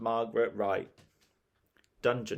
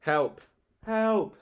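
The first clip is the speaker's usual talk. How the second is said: high-pitched cry for help; panicked storybook shriek; 11 semitones higher, 4 dB louder than usual. A narrator's reading. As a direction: shriek it, climbing higher. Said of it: "shrieked sour Susan".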